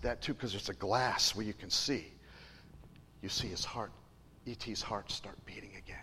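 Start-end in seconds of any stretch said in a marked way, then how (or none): 3.2-6.0 whispered, much softer. A narrator's reading. Preacher telling the congregation, whispered and hushed. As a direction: hush it like a secret.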